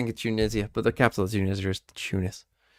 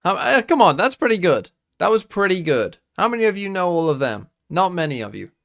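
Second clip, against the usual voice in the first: much higher and louder, crowing at the chat; excited taunting; 7 semitones higher, 8 dB louder than usual. Real, not acted. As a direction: boast to the viewers, loud and high. Taunting chat after quiz.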